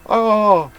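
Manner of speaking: high, loud pained scream; anguished cry. Acted. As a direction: cry out in sudden pain. Patient cries out in pain.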